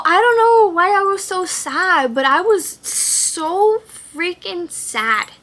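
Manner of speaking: high, loud, hammed-up wail of sadness; mock wail. Patient retelling waking up sad, hammed-up high wail. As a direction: wail it with drawn-out mock despair. Exaggerates her post-surgery sadness.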